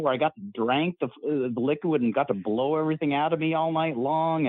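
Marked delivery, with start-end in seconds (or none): none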